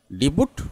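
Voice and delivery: DEBUT said डिबूट, 'di-boot' with final T; variant mispronunciation. Shown as a demonstration. Teacher quoting how some people say debut, mispronounced. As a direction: say डिबूट with a sounded t at the end. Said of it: "कोई कोई बोलते हैं".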